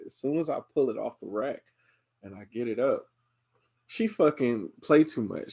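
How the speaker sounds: usual speaking voice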